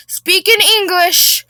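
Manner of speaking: high, loud, slow demand; hammed-up shouted insistence; irate Karen. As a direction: spell out the order in exasperation. Acted Karen demanding English.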